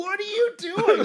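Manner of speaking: a coworker baffled at him gulping smoke, high and slow; incredulous coworker. Retold or imitated. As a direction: drag it out in disbelief. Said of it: "one of my coworkers is like".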